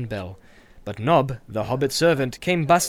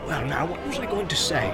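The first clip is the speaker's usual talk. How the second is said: bustling innkeeper. Acted. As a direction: greet the guest heartily. Landlord forgets.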